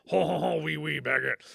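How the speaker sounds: put-on silly French accent joke; mock French accent. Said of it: "Again, my French accent".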